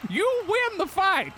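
high squeaky voice. Acted. Silly voice in mock fight.

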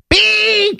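high squeaky bird chirp. Pretend. Device named like a bird.

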